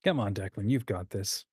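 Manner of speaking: usual speaking voice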